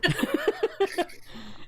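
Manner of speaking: high-pitched burst of laughter; squeaky giggle breaking out. Real, not acted. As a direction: laugh at how bad it sounds. Laughs at own line.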